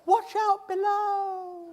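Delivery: high, slow, drawn-out call downward; mock warning shout. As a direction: call it down from the roof, stretched out long. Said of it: "with a shout of".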